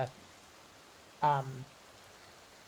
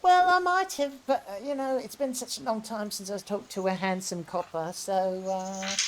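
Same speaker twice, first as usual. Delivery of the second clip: much higher, coy and teasing; 7 semitones higher, 4 dB louder than usual. Acted. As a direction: flirt coyly with the policeman.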